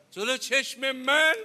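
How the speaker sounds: high, loud, drawn-out question; retold cry of a daughter's disbelief; stricken disbelief. Daughter asks father about what she will witness, stricken.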